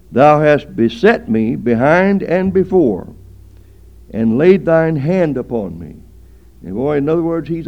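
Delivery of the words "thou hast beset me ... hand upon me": loud, declaimed Bible verse; scripture recitation. Real, not acted. Preacher quoting a psalm to the congregation, loud scripture recitation. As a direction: proclaim the verse with force.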